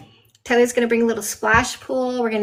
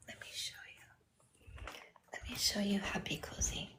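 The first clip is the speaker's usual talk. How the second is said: hushed, breathy hush over sleeping dogs; soft whisper; 3 semitones lower, 19 dB quieter than usual. Real, not acted. Whispering to viewers about the cozy puppies. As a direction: whisper so the puppies stay asleep.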